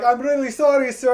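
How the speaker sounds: high-pitched mimicked Indian accent. Agent apologizing to angry caller, mock Indian accent. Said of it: "if I do the accent".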